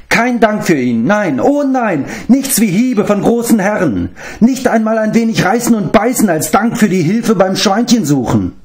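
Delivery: a pitiful little creature complaining; whining beast-man. Acted. Gurgi complains he gets no thanks, high whining creature voice.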